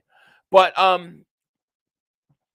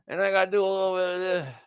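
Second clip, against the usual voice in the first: smooth talker; faster than usual. Pretend. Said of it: "You do talking like this".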